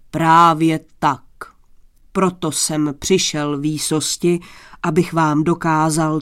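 low, firm, stately declaration; low, weighty courtly address; grandiose thunder-voiced prince. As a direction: offer your service with booming solemnity. Boastful stranger offers service.

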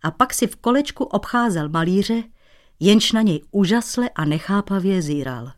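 ordinary delivery

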